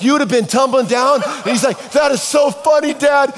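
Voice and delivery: high, loud laughing imitation of the son. Son laughing at dad's failed jump, high and loud.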